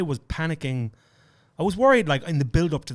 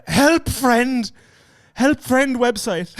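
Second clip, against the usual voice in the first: high, loud mock panic, slowing down; panicked blurting; 11 semitones higher, 8 dB louder, much slower than usual. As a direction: imitate a friend freezing up at the border. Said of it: "He panicked".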